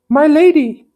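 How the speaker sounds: loud, high-pitched lady voice for the wrong character; high lady voice by mistake. Acted. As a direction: start the line in the lady's voice. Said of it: "No, this is him".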